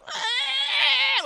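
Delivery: sudden high shriek; a loud high squawk of alarm; witch shriek. Retold or imitated. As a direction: shriek like a startled monster. Dirty Gertie startled by Mommy.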